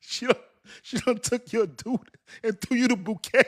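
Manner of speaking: laughing disbelief. Real, not acted. Laughing retelling of betrayal.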